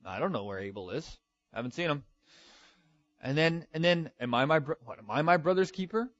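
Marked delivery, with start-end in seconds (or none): 0.0-2.0 softly voiced feigned ignorance; deceitful denial. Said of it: "he tries to deceive God". 4.2-6.1 mock-indignant question, higher, rising at the end; sassy. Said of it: "are you getting sassy with God?".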